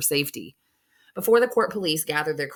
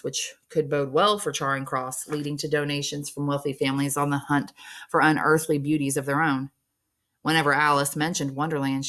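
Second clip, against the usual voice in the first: ordinary delivery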